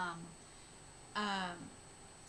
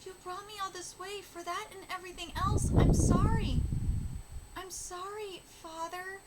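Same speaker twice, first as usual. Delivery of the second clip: mild tearful apology; 7 semitones higher, 6 dB louder than usual. Acted. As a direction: apologise gently as if dying. May's dying apologies read aloud.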